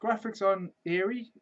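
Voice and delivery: soft, fast closing review; quiet outro. Real, not acted. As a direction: wrap up softly.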